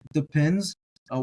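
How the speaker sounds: ordinary talk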